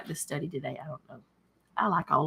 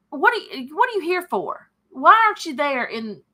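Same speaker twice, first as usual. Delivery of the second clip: disrespectful brother; 5 semitones higher, 5 dB louder than usual. Retold or imitated. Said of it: "he had no respect for David".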